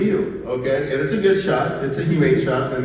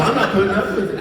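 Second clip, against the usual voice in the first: mock defiance; 10 semitones higher, faster than usual. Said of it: "play a trick".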